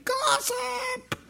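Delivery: very high, thin mock scream. Holy Spirit warning gossipers, high strangled mock scream. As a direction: squeal it out without full volume. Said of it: "the Holy Spirit screaming".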